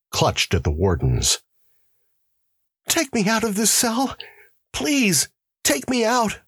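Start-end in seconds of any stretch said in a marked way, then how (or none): 2.9-6.4 much higher, desperate begging; terrified pleading. Acted. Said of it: "he pleaded".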